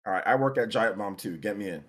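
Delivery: ordinary talk, nothing marked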